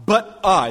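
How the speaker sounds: "but I" punched high and loud; stress on I. Shown as a demonstration. Preacher reading God's reply, stressing "I". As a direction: make "I" the turning point. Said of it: "There's a huge contrast there".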